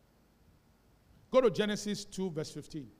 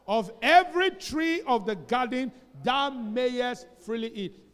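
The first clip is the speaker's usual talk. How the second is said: loud, slow, measured recitation of scripture; scripture reading; 5 semitones higher, 10 dB louder, slower than usual. Real, not acted. Pastor leading the church in reading Genesis, loud and slow. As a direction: lead the whole church through the verse.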